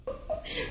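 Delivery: high breathless giggle; a short burst of giggling; airy giggle. Real, not acted. Friend giggles again between apologies, high and airy.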